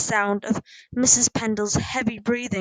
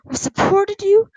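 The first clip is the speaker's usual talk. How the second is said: raised, pleading pitch with loud stresses; tearful reproach; 9 semitones higher, 5 dB louder than usual. A narrator's reading. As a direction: plead like a hurt mother. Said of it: "she sniffed".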